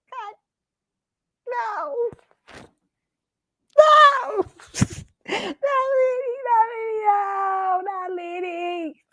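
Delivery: high, drawn-out tearful wail, fading; theatrical crying over a crush; mock heartbroken wail.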